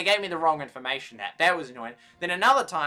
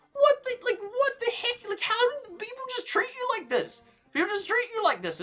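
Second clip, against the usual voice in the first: incredulous outburst. Real, not acted. Venting at hostile clerk.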